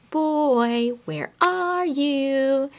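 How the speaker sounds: playful hide-and-seek voice; reading to children. Acted. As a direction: coax the child to look. Reading picture book to toddler.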